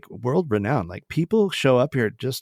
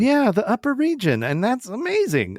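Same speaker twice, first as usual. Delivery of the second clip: excited; 11 semitones higher than usual. Real, not acted. Excited ironic reaction.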